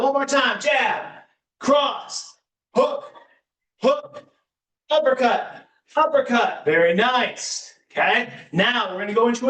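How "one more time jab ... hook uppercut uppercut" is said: higher, punchy call of each punch; boxing coach calling combos. Acted.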